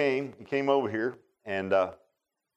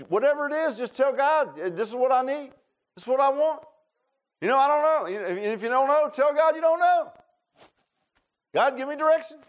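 high, loud preacher's push; full-force preaching; 6 dB louder than usual. Acted. Pastor urging the congregation, preaching at full force. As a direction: drive the point home to the pews.

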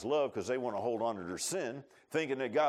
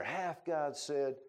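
Hath God said slowly, doubting tone; doubting drawn-out question; sly question; 3 semitones higher, much slower than usual. Shown as a demonstration. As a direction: stretch out Hath God said with suspicion. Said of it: "that's how I'm giving you my voice inflection".

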